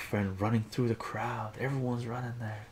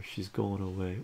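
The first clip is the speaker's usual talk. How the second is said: ordinary delivery, nothing marked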